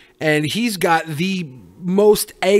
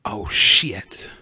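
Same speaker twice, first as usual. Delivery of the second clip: breathy whispered oh shit; whispering; 7 semitones lower than usual. Real, not acted. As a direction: whisper it under your breath. Reacting to the Slingshot threat.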